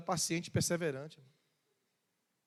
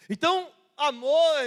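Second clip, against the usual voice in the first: raised preaching; 8 semitones higher, 8 dB louder than usual. Real, not acted. Preacher building up climax.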